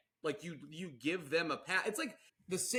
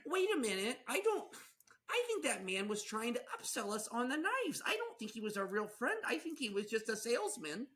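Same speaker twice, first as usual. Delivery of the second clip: high Midwestern voice of dawning doubt; hammed-up, naive Midwest suspicion; Midwest accent; 7 semitones higher than usual. Spouse realizes the salesman upsold them, high Midwest accent. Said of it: "It's the best accent".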